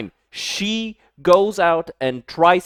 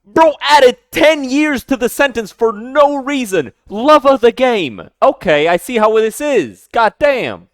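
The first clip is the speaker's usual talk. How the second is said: riled up; 9 semitones higher, 6 dB louder than usual. Real, not acted. Mock outrage at the clip.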